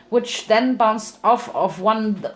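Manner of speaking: plain reading voice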